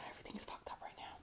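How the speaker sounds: hushed, fast, breathy whisper to herself; quick frustrated whisper aside. Real, not acted. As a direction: whisper it fast under your breath, fed up. Muttered frustration at messy contour.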